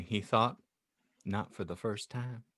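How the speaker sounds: ordinary talk, nothing marked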